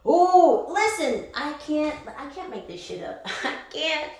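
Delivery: ordinary talk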